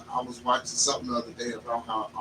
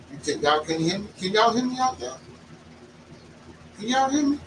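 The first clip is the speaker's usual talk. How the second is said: loud and high, calling out; 10 semitones higher, 6 dB louder than usual. Real, not acted. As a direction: call out to check they hear. Checking if audience hears him.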